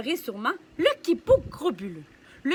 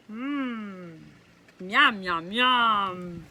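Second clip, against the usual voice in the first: yum monster; 6 semitones lower, much slower than usual. Acted. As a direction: growl the yums like a hungry monster. Tasting ketchup.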